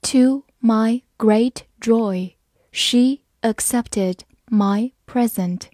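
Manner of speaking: slow, careful read-aloud; slow teacher demo. Shown as a demonstration. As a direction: read it slowly word by word. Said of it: "好，慢慢来读".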